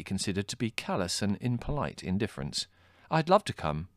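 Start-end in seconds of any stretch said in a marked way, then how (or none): 3.1-3.9 put-on higher, keen young man; eager. A narrator's reading. Adrian accepting Margot's walk, eager. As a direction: jump at the invitation. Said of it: "said Adrian".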